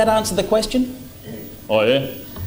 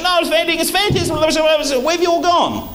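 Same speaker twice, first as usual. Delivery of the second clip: gushing salesman; 7 semitones higher than usual. Pretend. Salesman gushing to a crowd that has left, hammed-up. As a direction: ham up the gushing salesman as the room empties. Salesman's mock pitch ends.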